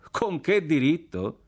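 slow, high, drawn-out echo; scornful echo. A narrator's reading. Partisan officer repeats the challenge back, scornful echo. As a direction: throw the question back slowly, pitched high.

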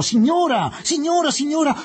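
high, loud furious cry; furious shout. Acted. Aziz shouting at the woman in the mosque, furious. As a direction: yell at the intruder in sudden rage. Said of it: "Aziz gridò".

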